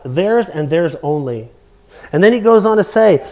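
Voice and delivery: ordinary talk, nothing marked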